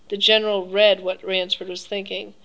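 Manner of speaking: plain reading voice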